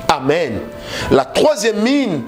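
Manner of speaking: ordinary delivery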